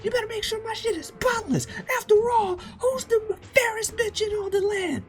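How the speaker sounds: very high, loud falsetto woman; bossy pirate lady. Acted. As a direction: screech orders at the crew like a tyrant. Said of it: "We cut to this lady".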